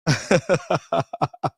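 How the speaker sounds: short soft mocking laugh; quiet derisive chuckle. Real, not acted. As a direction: laugh at him under your breath. Mocking Brother Moses' look.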